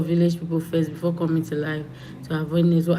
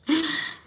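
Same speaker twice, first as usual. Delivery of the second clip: long high theatrical sigh; mock sigh of envy; high dramatic sigh; 11 semitones higher than usual. Real, not acted. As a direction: sigh like you're pining. After saying she is jealous.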